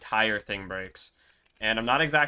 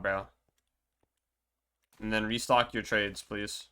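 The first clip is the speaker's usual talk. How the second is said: ordinary talk, nothing marked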